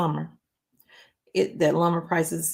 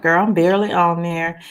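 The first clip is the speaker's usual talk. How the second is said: ordinary talk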